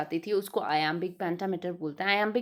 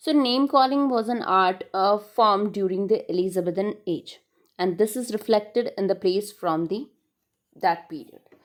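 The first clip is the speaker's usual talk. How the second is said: slow, louder read-aloud of the text; slow reading of notes; 5 dB louder, much slower than usual. Acted. Teacher reading English notes to her class, slow and louder. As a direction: read it out slowly, phrase by phrase.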